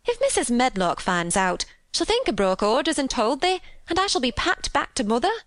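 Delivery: rising, fretful worry; anxious Yorkshire maid, ending high; agitated. A narrator's reading. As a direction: let the pitch climb as the dread grows. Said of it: "cried agitated Martha".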